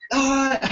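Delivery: high mock shriek; mock scream. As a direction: imitate a child's shriek, lightly. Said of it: "screaming stuff like".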